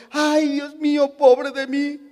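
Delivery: un poco más agudo, lamento fingido; quéjate como víctima, sin fuerza; queja lastimera. Retold or imitated. José quejándose, hipotético. (lamento teatral, ligeramente agudo)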